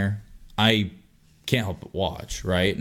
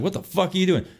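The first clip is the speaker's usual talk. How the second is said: raised retelling; 9 semitones higher than usual. Retold or imitated. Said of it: "I just word for word".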